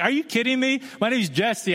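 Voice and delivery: incredulous, fed-up retort; annoyed. Retold or imitated. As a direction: ask it like you can't believe she forgot. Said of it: "she could tell I was kind of annoyed".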